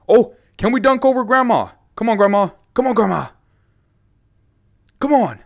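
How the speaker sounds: hyped gamer taunting grandma; excited taunting. Real, not acted. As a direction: egg her on gleefully. Excited taunting during game.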